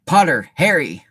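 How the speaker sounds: loud, slow announcement of the name; slow proud roll call. Acted. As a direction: call the name out across the hall. Hat calling Harry's name.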